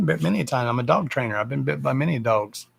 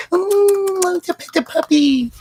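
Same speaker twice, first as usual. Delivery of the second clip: much higher, louder squeal of a pushy stranger; mocking people who rush at a scared dog; mock squealing dog lover; 7 dB louder than usual. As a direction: gush at the puppy like a clueless stranger. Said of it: "keep walking up to it going".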